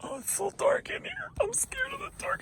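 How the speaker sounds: very high whispered scared little creature; scared bunny voice. Pretend. Voicing the toy bunny, high and scared in the dark. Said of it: "He's like".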